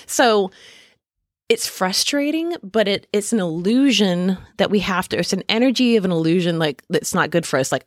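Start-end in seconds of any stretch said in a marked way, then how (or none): none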